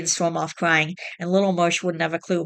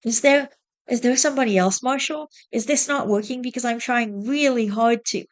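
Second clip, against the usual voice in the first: holding back tears; 7 semitones higher than usual. Acted. Wife asking husband if there is someone else, near tears. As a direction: fight not to cry. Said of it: "trying desperately not to cry".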